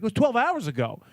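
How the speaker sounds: high-pitched retold arguing; mock exasperation. Imitating the argument over missing Prosecco, mock exasperation.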